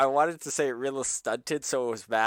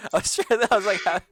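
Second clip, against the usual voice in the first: usual speaking voice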